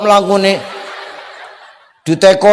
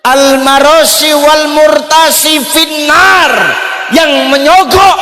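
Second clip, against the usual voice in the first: forceful recitation; 7 semitones higher, 3 dB louder than usual. Acted. Arabic hadith recited forcefully.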